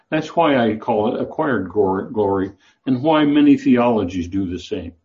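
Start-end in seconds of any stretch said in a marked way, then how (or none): none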